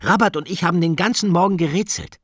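high, loud, rushing eagerness; excited call. A narrator's reading. Ida tells Katinka about puzzling all morning, excited call. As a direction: gush it out fast to a friend. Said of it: "rief Ida".

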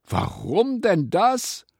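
loud, high, drawn-out question; astonished cry. A narrator's reading. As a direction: call it out in puzzlement. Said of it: "rief Ram". (verwundert gerufen)

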